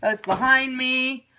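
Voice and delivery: ordinary delivery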